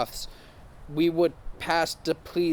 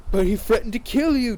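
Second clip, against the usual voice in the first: lightly voiced Draco, higher and louder; pleading Draco, pitched up; a hint of Draco; 7 semitones higher, 5 dB louder than usual. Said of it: "Draco said".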